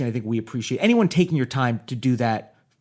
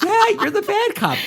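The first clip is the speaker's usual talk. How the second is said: laughing; 4 dB louder than usual. Real, not acted. Laughing confirmation.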